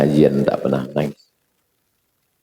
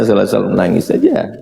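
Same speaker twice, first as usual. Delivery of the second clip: ordinary talk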